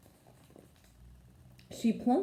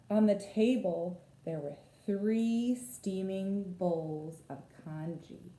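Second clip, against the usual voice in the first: ordinary delivery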